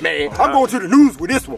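ordinary talk, nothing marked

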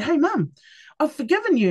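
telling mum she's forgiven, breezy and high; light and free. Retold or imitated. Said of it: "You're so light and so free about it".

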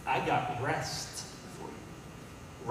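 slow, soft, breathy-voiced invitation; lightly voiced Jesus, hushed. Preacher voicing Jesus gently to tired listeners, slow and soft. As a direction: offer rest slowly, almost whispered. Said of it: "He says".

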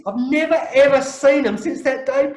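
NEVER and EVER hit high and loud; emphatic punchline. Real, not acted. Storyteller landing the stalker's disappearance, emphatic and loud. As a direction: raise pitch and volume on "never, ever".

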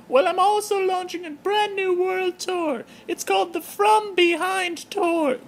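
high falsetto diva voice, loud and pleased; Terry the giraffe. Acted. Terry the giraffe greeting her blog fans in falsetto.

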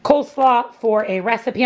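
usual speaking voice

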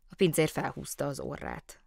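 usual speaking voice

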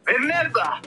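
mock divine voice. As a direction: proclaim it like a mighty god. Playful godlike persona warning.

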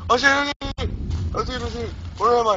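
high, loud panicked cries; lost in the dark and yelling in panic. Real, not acted. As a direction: yelp in panic.

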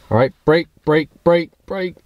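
shouted brake commands, drilled fast; yelling commands. Shown as a demonstration. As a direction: bark "brake" at the co-pilot. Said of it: "if I start yelling".

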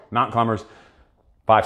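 normal talking voice

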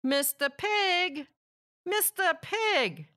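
calling to a pig who won't answer. A narrator's reading. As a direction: call through the brick door. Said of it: "I called".

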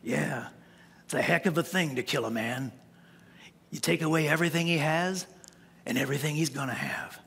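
low, quiet gunfighter voice, fading to a whisper; a hint of a weary old gunslinger, dropping low; Clint Eastwood. Pastor retelling the Western to his church, low and quiet. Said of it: "He says".

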